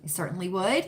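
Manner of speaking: ordinary delivery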